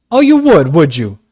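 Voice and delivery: high, loud ferocious retort; John turning fiercely on Kismine. A narrator's reading. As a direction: snap back ferociously. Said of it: "cried John ferociously".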